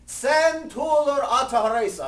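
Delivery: high, loud chanted call; loud sing-song pledge call. Retold or imitated. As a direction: ring it out across the room. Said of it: "vice president calls out pledge".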